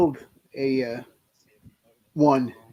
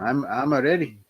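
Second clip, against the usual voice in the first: put-on Scottish accent; 3 semitones lower, slower than usual. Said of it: "is that your best Scottish accent?".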